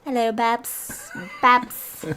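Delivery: put-on lightly done Birmingham accent; mock Birmingham accent. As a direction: stretch out hello in a jokey Birmingham accent. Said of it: "I've learned what a Birmingham accent is".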